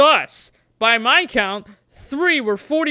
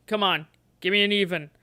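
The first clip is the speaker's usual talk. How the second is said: ordinary delivery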